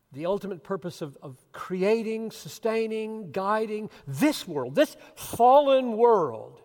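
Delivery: building from loud to full shout; rising to a shout. Real, not acted. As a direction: climb to a fervent peak. Sermon climax building loud.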